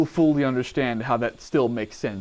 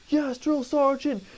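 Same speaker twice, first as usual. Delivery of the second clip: barked military recruit reply; 11 semitones higher, slower than usual. Retold or imitated. Recruit answering drill sergeant.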